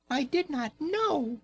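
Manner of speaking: very high, tiny squeaking mouse; squeaky mouse voice. Acted. Mamma mouse pleading with Raggedy Ann, very high squeak. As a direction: squeak it out like a frightened little mouse. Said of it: "squeaked the little mouse".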